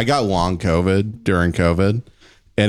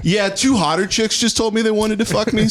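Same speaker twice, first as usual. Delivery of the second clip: laughing; 12 semitones higher than usual. Real, not acted. Laughing through the punchline.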